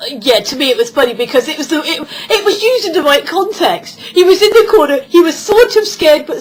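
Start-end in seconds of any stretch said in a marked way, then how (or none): none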